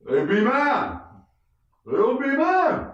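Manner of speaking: high, drawn-out call from a rooftop; calling from afar. Retold or imitated. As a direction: imitate a brother hollering down. Brother calls down from rooftop.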